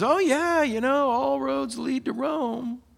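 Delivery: eager churchy guy. Retold or imitated. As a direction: play the overeager guy proudly reciting a slogan. Same man voiced again.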